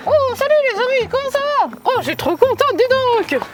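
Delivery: high, fast chirpy puppet; squeaky happy doll voice; ventriloquist puppet voice. Shown as a demonstration. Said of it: "tu modifies ta voix, tu fais".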